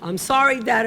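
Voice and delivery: usual speaking voice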